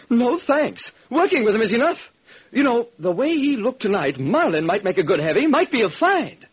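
a dainty producer making small talk; fussy studio man. Acted. Producer inviting the detective along, in a high chatty voice. Totten refuses to visit Gould.

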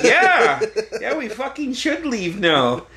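high, exasperated retold agreement; emphatic agreement. Son agreeing with girlfriend to leave, mortified and emphatic. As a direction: act out a mortified, urgent yes.

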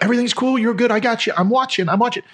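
high-pitched, placating "all good" voice; retold reassurance. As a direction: reenact calming someone down, pitched well up. Waves to reassure angry driver.

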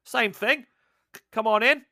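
brash Aussie banter, hammed up; Aussie barmaid. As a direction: barmaid dismissing the mute Russian, Australian accent.

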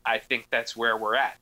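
ordinary talk, nothing marked

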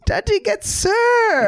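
very high, drawn-out crowing; mock swagger. Comedian revelling in being called sir, mock crowing. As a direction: crow it slowly, playfully.

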